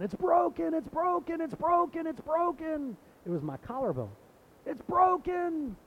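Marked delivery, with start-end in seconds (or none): 0.0-3.0 high-pitched panicked repeated cry, much higher; panicked child screaming. Retold or imitated. Little boy after the pop, screaming in panic. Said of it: "I just started screaming". 4.7-5.8 slow, drawn-out high wail, much higher; panicked child wail. Retold or imitated. Boy with broken collarbone, wailing slowly in panic. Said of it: "I just started screaming".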